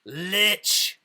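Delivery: a hint of a raspy voice, muffled; lightly voiced muffled rasp. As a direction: rasp the word from inside the bag. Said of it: "a raspy voice respond".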